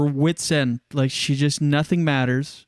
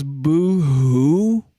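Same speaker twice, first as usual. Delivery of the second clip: mock taunting boo-hoo; 3 dB louder than usual. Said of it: "someone goes".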